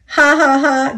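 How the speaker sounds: hammed-up storybook guffaw; mock guffaw. A narrator's reading. The cars laugh at the joke about Gordon, mock guffaw. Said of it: "guffawed the cars".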